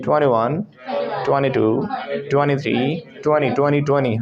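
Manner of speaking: "ट्वेंटी" said as twunny; dropped t. Shown as a demonstration. Teacher drills numbers, "ट्वेंटी" said as twunny. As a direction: drop the second t of each "ट्वेंटी". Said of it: "N के बाद टी गायब कर दो".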